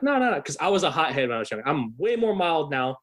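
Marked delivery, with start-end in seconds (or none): 0.0-0.4 heated refusal. Retold or imitated. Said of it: "I'm like".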